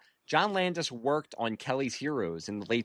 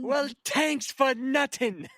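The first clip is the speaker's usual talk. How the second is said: high-pitched, slowed mock Irish accent; crappy Irish accent; 13 semitones higher, 3 dB louder, slower than usual. Podcaster mimicking Maggie from Caddyshack, crappy Irish accent. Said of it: "even though it was a crappy Irish accent".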